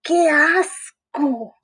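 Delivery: slow, high cry of disgust. Acted. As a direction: recoil from the smell. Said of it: "exclamó Froggy". (qué asco, lento y agudo)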